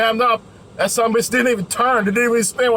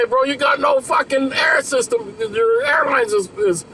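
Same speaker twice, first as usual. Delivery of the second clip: higher, shouted warning to the driver; alarmed shout; 6 semitones higher than usual. Retold or imitated. Mechanic under the truck, shouting to the driver. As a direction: call it out, alarmed. Mechanic reports no air system.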